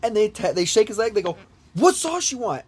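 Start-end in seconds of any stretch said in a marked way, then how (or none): 1.7-2.6 much higher, insistent retold question; high-pitched mock nagging to wake someone; insistent wake-up call. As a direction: imitate a friend pressing a sleeper for an answer. Shaking the sleeper to wake him.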